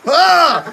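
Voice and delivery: sudden, very loud, high scream; scream of pain. Retold or imitated. Nurse presses his swollen appendix, sharp loud scream. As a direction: shriek as if jabbed right in the sore spot.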